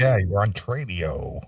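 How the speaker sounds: low-pitched mock host sign-on; mock radio host. Co-host echoes the Tradio greeting, mock radio host. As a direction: drop low for a lazy radio-host send-up.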